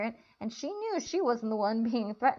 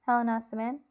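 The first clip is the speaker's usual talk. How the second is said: plain reading voice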